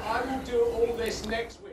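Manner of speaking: slow, soft, firm refusal; quiet firm refusal. Real, not acted. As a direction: turn him away quietly. Refusing, muttered at door.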